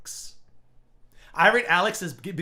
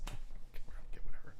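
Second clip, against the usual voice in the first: unvoiced, breathy brush-off; whispering a dismissive okay, whatever to a rival; whispered dismissal; 5 dB quieter than usual. Real, not acted. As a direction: whisper it like it's beneath you. Dismissive aside.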